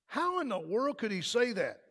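usual speaking voice

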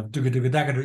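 rapid-fire mouthed drum pattern; vocalized drum beat. Shown as a demonstration. Drummer showing the sixteenth-note groove, rapid vocalized beat. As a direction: rattle off the sixteenths as quick syllables.

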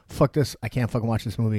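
loud exasperated rant; mock furious rant at the screen; retold outburst. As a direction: blurt it out, fed up and loud. Ranting at over-the-top movie.

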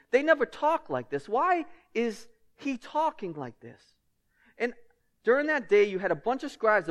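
loud, rising pulpit fervour; preaching at full force. Acted. Preacher pressing his church on authority, fervent and loud. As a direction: drive the point home to the pews.

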